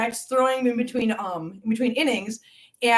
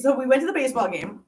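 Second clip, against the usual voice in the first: usual speaking voice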